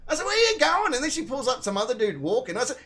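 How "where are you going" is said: high, loud call after someone; retold yelling. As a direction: call after her indignantly. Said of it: "as I'm yelling at her".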